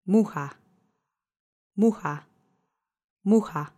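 Mucha with the stress on MU, said MOO-ha, clear and falling; stressed first syllable. Shown as a demonstration. Host models Mucha for listeners to repeat, MOO-ha stressed first. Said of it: "The accent is on the first syllable".

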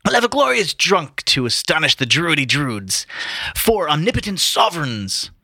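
high, exuberant declamation; delighted cry. A narrator's reading. Mulligan planning a glorious drunk, crying with delight. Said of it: "Buck Mulligan cried with delight".

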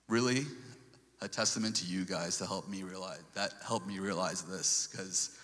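normal talking voice

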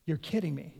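a soft aside of disbelief; quiet mock disbelief. Mock reaction to own point.

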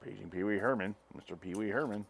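quiet, calm hotel paging voice; soft hotel lobby announcement. Pretend. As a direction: page a guest gently over the lobby speaker.

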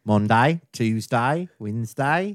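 weekdays in broad Australian vowels; Australian accent. Shown as a demonstration. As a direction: list the days as an Aussie. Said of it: "I do the days of the week".

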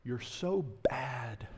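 a slow heavy verdict; slow, grave. Acted. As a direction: drag it out slowly and softly. Last statement, slow and low.

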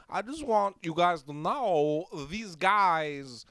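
slow, drawn-out Walken impression; Christopher Walken. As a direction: stretch the words out like a celebrity impressionist. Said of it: "the owner looks like Christopher Walken".

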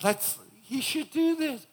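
high, slow mock-female voice; imitating the ladies. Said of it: "most of you speak like that".

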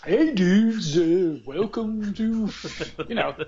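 a light put-on dude host greeting; mock podcast host. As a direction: ham up a podcast opening, slowly. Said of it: "This is you going".

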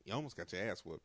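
a lightly dropped voice; low menacing imitation. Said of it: "he didn't have to drop an octave".

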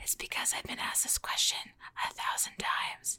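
whispered aside. Retold or imitated. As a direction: confide it under your breath, slowing at the end. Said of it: "I'm like".

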